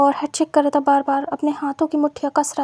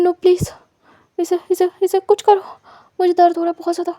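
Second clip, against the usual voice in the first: higher, louder, anguished begging; pained pleading; 4 semitones higher, 3 dB louder than usual. Acted. Wang in pain begs Wing to fix it, anguished.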